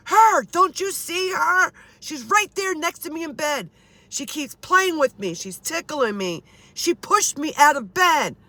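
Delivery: high, urgent mock yelling as Dad; retold cry of an upset father; imitated frantic insistence. Acting out Dad's screaming insistence.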